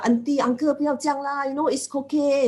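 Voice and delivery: high, gentle coaxing to auntie and uncle; gentle salesperson coaxing. Retold or imitated. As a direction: sweet-talk the customer kindly. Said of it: "they're very kind, they're very gentle".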